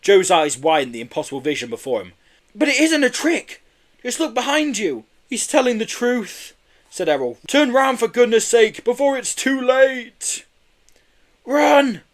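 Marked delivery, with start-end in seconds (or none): 2.6-10.4 high voice pressing him to look; high, urgent pleading. A narrator's reading. Boys urging villain to turn. 11.4-12.0 high, loud yell. A narrator's reading. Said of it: "yelled Kenny".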